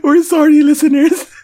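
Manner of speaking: high whiny sorry; mock crying apology. Apologizing for buzzing mess.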